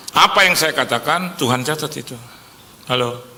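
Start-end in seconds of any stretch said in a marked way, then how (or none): none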